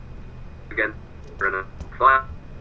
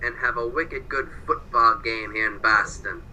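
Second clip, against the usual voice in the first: mock Boston accent; 3 semitones higher than usual. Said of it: "my bad Boston accent".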